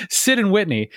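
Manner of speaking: giggling over the name mix-up; laughing at himself. Real, not acted. Host laughing at his own slip over the guests' names.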